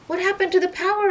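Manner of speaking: high, loud anxious question; scared in the dark; nervous. Acted. Carlos asking in the blackout, high and nervous. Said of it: "asked Carlos nervously".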